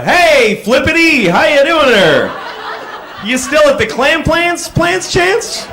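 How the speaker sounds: high, loud, unhinged hello; crazy loud greeting. Acted. To a guy whose name he forgot, loud and unhinged. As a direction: greet him like a madman. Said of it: "Just go".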